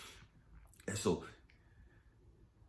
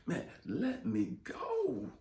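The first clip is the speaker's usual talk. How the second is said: much higher, slow drawn-out plea; pleading; 11 semitones higher, much slower than usual. Retold or imitated. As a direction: beg high and slow, drawing out go. Pleading while wrestling with God.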